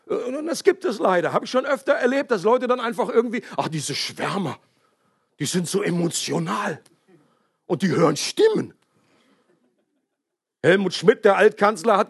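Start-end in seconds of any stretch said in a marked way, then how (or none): 3.6-8.7 leiser, abschätziger Spott über Schwärmer; spöttisch nachgeahmt. Retold or imitated. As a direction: herablassend nachäffen, halb gespielt. Leute die über Schwärmer spotten. (leicht gespielter Spott der Skeptiker über die Schwärmer)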